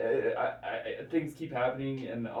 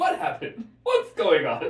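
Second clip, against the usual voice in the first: higher, louder, bewildered newsman; Tucker Carlson impression; 6 semitones higher, 10 dB louder than usual. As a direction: play him baffled and indignant. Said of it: "my head is going".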